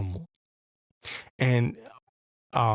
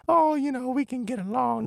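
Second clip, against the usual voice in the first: much higher, mincing mock of a people-pleaser; high, sugary parody of someone too nice; mocking nicey-nice voice. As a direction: sing it up high like a naive peacemaker. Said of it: "He don't want you to be all nicey, nicey, nicey".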